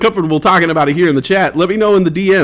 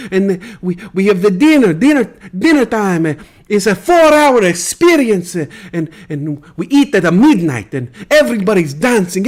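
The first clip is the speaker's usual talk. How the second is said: higher, hammed-up Italian accent, swooning over Milan nights; 3 semitones higher than usual. [name] compares hot pockets with Milan dinners, hammed-up Italian accent. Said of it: "the [name] Show".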